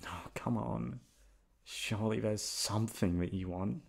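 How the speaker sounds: normal talking voice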